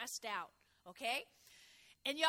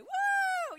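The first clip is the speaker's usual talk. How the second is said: very high, loud whoop of praise; joyful whoop; 12 dB louder than usual. Real, not acted. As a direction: shout for joy at the victory. Preacher celebrates victory verse.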